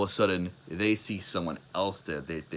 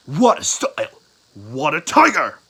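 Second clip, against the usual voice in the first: booming, higher battle cry; shouted attack; 8 semitones higher, 14 dB louder than usual. Acted. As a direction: bellow the move, drawing out the second part. Move shouted from deep water.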